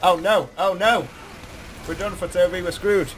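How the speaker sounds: ordinary talk, nothing marked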